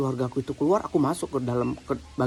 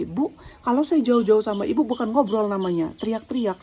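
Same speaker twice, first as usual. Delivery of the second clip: higher-pitched heated retort; retold angry outburst; 7 semitones higher than usual. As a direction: retell arguing back at the clerk. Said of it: "Saya emosi banget".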